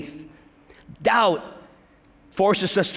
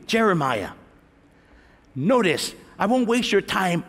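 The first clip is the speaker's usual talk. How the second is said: ordinary talk, nothing marked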